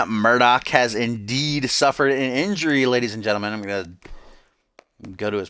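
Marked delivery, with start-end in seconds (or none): 0.0-3.5 wrestling announcer. Acted. Announces injury as commentator.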